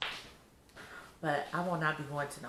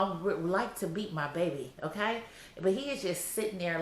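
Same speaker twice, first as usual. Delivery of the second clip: usual speaking voice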